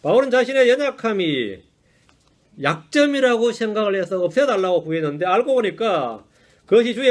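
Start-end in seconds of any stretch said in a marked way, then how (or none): none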